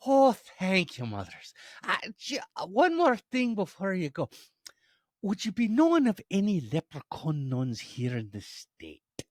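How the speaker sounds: high, loud, lilting leprechaun; politely persistent leprechaun voice. Acted. Leprechaun pressing Mother Superior further, high eager lilt.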